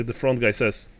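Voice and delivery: ordinary delivery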